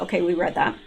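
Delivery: ordinary talk, nothing marked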